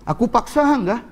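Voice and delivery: high, incredulous question; startled questioning. Acted. Man questioning the crying woman, startled and incredulous. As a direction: ask in high-pitched disbelief.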